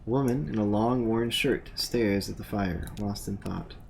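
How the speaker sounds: low, even game narrator voice; scene narration. A narrator's reading. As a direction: describe the scene like a game master.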